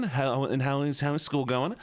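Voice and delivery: ordinary delivery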